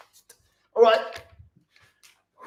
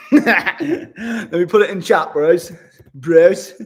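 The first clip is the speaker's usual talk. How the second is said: louder laughing call, slowing down; 8 dB louder, much slower than usual. Real, not acted. Laughing to the chat bros while posting the workout.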